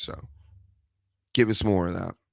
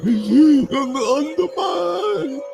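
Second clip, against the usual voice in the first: much higher, slow, drawn-out mock wrestler boast; mocking Becky Lynch; 6 dB louder, much slower than usual. Podcaster mocking the wrestler's entrance with a high drawn boast. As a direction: ham up her entrance catchphrase, stretched and squeaky. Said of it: "then she comes out".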